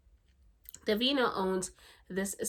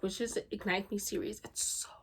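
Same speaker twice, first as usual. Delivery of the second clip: ordinary talk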